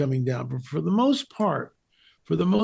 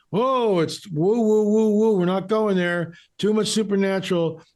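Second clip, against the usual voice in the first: mock alarm; 5 semitones higher than usual. Guest imitates theologians shying from the supernatural, mock alarm. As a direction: ridicule the skittish crew, stretching the woos. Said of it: "you get the whole crew with Augustine".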